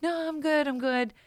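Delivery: high-pitched insistence; lightly acted, high "I'm fine" voice; retold brave reassurance. Overwhelmed, retold insisting she's fine.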